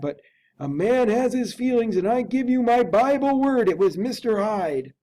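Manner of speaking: put-on a frightened old butler unsure of what he saw; Poole the butler. Butler tells the lawyer about the masked figure, high and fretful. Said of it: "returned Poole".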